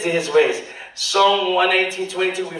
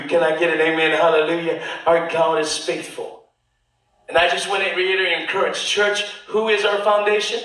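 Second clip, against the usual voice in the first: ordinary talk, nothing marked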